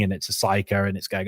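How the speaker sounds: ordinary talk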